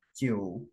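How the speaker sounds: "jiu" short and low, no final rise; low half third tone. Shown as a demonstration. Teacher counts the right way, "jiu" only low. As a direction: drop "jiu" low and stop there. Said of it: "jiunya juga langsung rendah doang".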